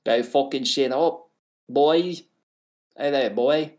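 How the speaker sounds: exaggerated Australian bloke; mock Australian accent. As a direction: play a rowdy Aussie lad. Riffing as Aussies.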